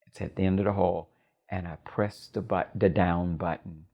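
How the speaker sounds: ordinary delivery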